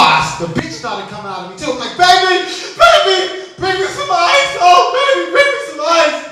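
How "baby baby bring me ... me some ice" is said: high, loud cry for help; hammed-up desperate wail, far above usual pitch; panicked yell. Man yelling from the toilet for ice, mock panic.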